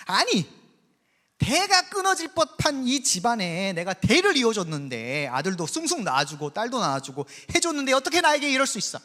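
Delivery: hammed-up jealous wife yelling at her husband; a rival wife fuming at favoritism; angry outburst. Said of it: "성질을 내는 거예요".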